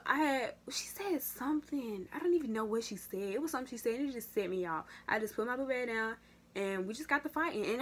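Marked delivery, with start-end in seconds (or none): none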